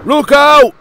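high, loud warning shout. A narrator's reading. Driver warns the runaway engine, high loud shout. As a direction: shout it as an urgent alarm. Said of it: "he shouted".

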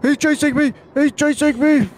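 panicked high yell; being chased. Real, not acted. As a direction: scream it while running away. Monster chasing player.

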